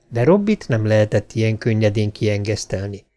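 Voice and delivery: ordinary delivery, nothing marked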